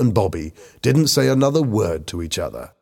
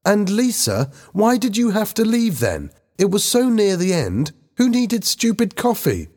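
high-pitched, accusing outburst; shouting; 13 semitones higher than usual. A narrator's reading. Helen turns the blame on Lisa, shouting. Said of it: "Helen shouted at Lisa".

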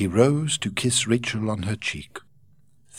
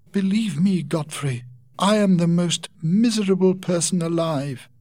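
higher, louder anguished lament; Rachel crying out; 10 semitones higher, 3 dB louder than usual. Acted. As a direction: cry out your misery. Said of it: "Rachel cried".

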